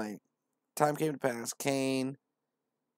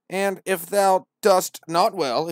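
slow, louder preacher line; solemn verse; 8 semitones higher, 12 dB louder, slower than usual. Acted. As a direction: proclaim the question. Verse read out.

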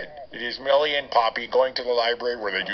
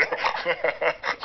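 snort laugh; 4 semitones higher, 4 dB quieter than usual. Acted. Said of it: "I didn't even know I could snort".

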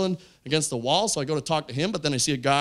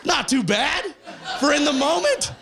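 high-pitched, pleased call over the laughter; pleased exclamation; 11 semitones higher than usual. Real, not acted. Comic to a laughing crowd, high and pleased.

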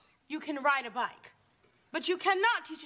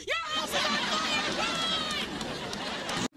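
panicked shout; 12 semitones higher, much slower than usual. Acted. Warning kids their house is burning, a panicked high yell. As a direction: shout it like the flames are right there.

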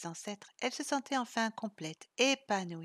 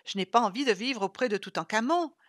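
louder outcry; exclaiming; 8 dB louder than usual. Acted. Isa reacting to Vlad's unchanged hands, loud exclaim. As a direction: blurt it out. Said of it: "s'écria Isa".

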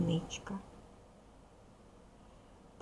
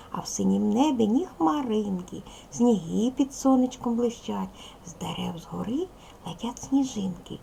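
put-on bright, lively reading of a children's poem, louder and higher; poem recitation; 3 semitones higher, 5 dB louder than usual. Shown as a demonstration. Teacher reciting a winter poem to young pupils, lively and bright. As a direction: recite it to the class with joy.

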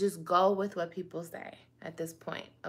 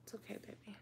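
much softer shushing whisper; hushing whisper; 22 dB quieter, much faster than usual. Pretend. Hushing the pretend baby off camera, soft whisper. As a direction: soothe the baby so it stays asleep.

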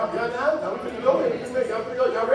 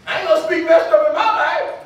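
raised, louder declaration; emphatic preaching; 6 semitones higher, 5 dB louder than usual. Real, not acted. Preacher refusing negative words over life, emphatic. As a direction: declare it firmly, voice lifted.

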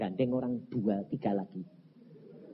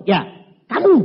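loud, raised pointed accusation; acted accusation; 8 semitones higher, 13 dB louder than usual. Pastor tells the husband he is the one, accusing. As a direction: throw it out hard at the culprit.

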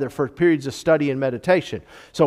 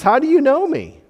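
pitched much higher in astonished disbelief; higher, startled how-do-you-know-me; surprised question; 10 semitones higher, 4 dB louder than usual. Retold or imitated. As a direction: ask it like you're caught off guard. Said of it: "He says".

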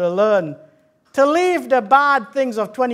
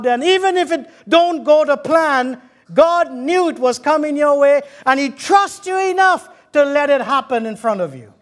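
raised, emphatic preacher's delivery; preaching; 5 semitones higher, 4 dB louder than usual. Acted. Preacher telling the congregation of gratitude to God, preaching. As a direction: preach it out with conviction.

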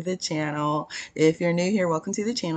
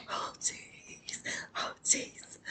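breathy whispered call; 15 dB quieter, much slower than usual. Pretend. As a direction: whisper it secretly.

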